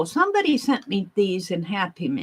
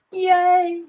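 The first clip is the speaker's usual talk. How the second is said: excited cheer; 10 semitones higher, 5 dB louder than usual. Real, not acted. Delighted at finding supplies.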